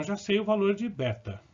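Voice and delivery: normal talking voice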